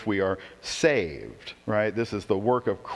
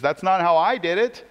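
voicing God; 7 semitones higher, 4 dB louder than usual. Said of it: "And he says".

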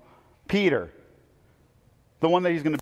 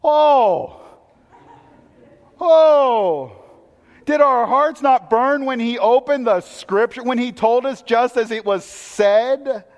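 louder, slow, awestruck outcry; amazed exclamation; 4 semitones higher, 4 dB louder, slower than usual. Acted. Disciples amazed at their burning hearts, loud and slow. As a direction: burst out in wonder, then slow down.

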